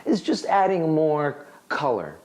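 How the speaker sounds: normal talking voice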